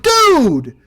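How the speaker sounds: high, loud startled yell; startled shout. Retold or imitated. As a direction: yell as the cat flies at your head. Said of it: "I put my hands up and I'm like".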